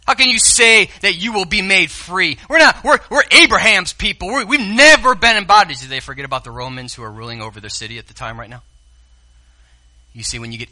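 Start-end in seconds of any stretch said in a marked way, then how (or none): 0.1-5.8 louder, higher protest, hammed up; indignant religious leaders objecting loudly; mock indignant Pharisees. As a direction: act out the offended crowd shouting back. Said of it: "really mad".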